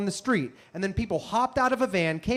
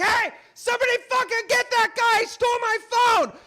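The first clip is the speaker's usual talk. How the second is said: yelling for help. Retold or imitated. Victim yelling at passersby to stop the phone thief. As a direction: shout after the thief. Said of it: "So I have to start yelling".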